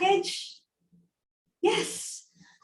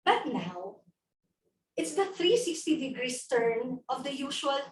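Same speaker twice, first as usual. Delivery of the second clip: ordinary talk, nothing marked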